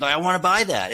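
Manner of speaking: hammed-up eagerness, a customer who must have it; eager buyer. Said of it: "you would".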